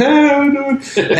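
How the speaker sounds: high, slow, welcoming diner owner; friendly greeting voice. Retold or imitated. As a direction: greet them like a beaming host. Voicing Hassan's parents greeting.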